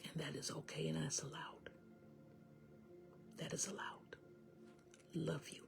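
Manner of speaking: soft, half-whispered comfort with long pauses; hushed healing message. Real, not acted. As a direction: guide them gently through grief, very quietly.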